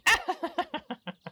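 high, scornful nobleman's laugh; haughty laugh. Acted. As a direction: burst into a high derisive laugh. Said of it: "busts out laughing".

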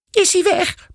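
very high, timid squeak. A narrator's reading. Mother peeking out from the doorway, squeaking. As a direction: peep it out nervously. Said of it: "piept ze".

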